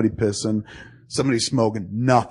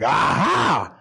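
higher, exclaimed catch in the act; triumphant gotcha; 4 semitones higher than usual. Retold or imitated. Man catches friend leaving the bathroom, triumphant exclamation. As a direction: pounce on the word as if catching someone. Imagined pouncing on friend caught out.

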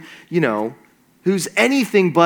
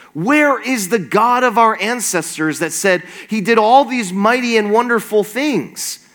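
higher-pitched, incredulous sarcastic protest; angry sarcasm; 5 semitones higher than usual. Acted. Gideon angrily answering God's promise to be with him, sarcastic. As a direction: challenge it with sarcasm, pitch climbing. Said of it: "the sarcasm dripping from his words".

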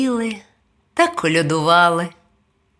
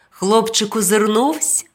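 usual speaking voice

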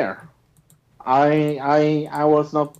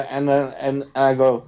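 normal talking voice

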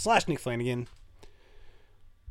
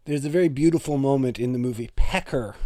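ordinary talk

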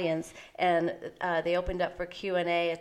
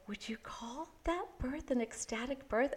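hushed, higher whispered question; confidential whisper; 6 semitones higher, 10 dB quieter than usual. Retold or imitated. Doctor privately asking colleague at panel, hushed whisper. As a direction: whisper it across a room, privately.

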